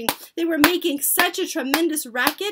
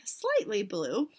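ordinary delivery, nothing marked

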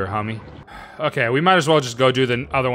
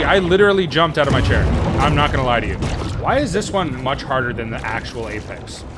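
ordinary talk, nothing marked

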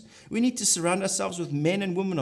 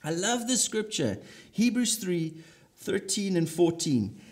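ordinary talk

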